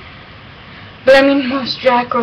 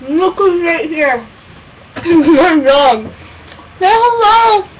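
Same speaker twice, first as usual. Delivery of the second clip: talking to a dog; 7 semitones higher, 3 dB louder, slower than usual. Real, not acted. Baby talk to her dog.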